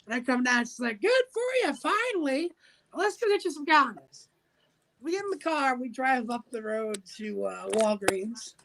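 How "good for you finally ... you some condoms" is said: high chirpy mom voice; lightly voiced proud mom. Mom congratulating her son in a high voice. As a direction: cheer him on like a proud mother. Mom greets him at stairs.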